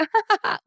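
surprised little laugh; high burst of laughter. Real, not acted. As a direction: laugh at yourself, caught off guard. Laughs at own line.

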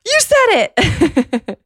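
high, loud squeal breaking into laughter; drawn-out, high-pitched mock squeal; excited exclamation. Retelling her reaction to the confession.

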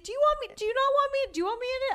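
very high, timid mock voice; awkward asking. Photo taker imagining herself asking fans to join, awkward. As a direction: ask awkwardly in a squeaky-high put-on voice. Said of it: "awkwardly be like".

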